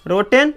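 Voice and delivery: रोटेन as "roh-ten", clear "e"; spelling reading रोटेन, "e" before n; mispronunciation demo. Shown as a demonstration. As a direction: say रोटेन wrongly with "-ten" at the end. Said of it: "ये गलती हो जाएगा".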